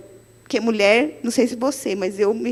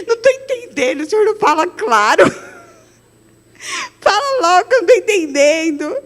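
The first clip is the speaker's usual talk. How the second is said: high, whiny plea breaking into a laugh; mock whining; 6 semitones higher than usual. Preacher begging God to speak clearly, high laughing whine. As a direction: whine like a frustrated child.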